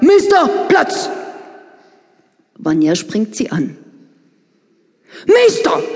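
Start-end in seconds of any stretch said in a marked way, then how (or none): 0.0-1.1 high, loud dog command. Acted. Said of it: "beugt sich gebieterisch über ihn und sagt". 5.3-5.8 very high, loud sharp call; more energetic shout. Acted. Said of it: "ruft Vera energischer".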